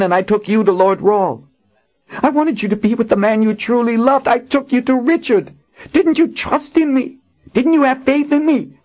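high, urgent woman warrior; a distraught guard pleading high; Kara. Acted. Kara voiced in the story.

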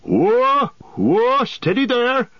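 high, loud Whoa to halt them; booming halt; loud cry. A narrator's reading. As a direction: call out to stop them in their tracks. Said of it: "cried the old man".

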